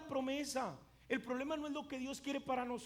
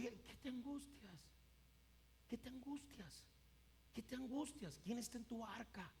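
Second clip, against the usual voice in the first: very soft, soothing questions; acted tender comfort; calm reassurance; 9 dB quieter than usual. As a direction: hush someone's anguish, gently and unhurried. God calmly soothing the anguished, acted.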